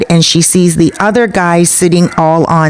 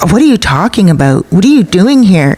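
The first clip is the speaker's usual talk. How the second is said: normal talking voice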